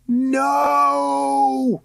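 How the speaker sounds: put-on high frightened boy's cry; terrified scream. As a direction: scream in terror. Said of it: "Roddy screamed".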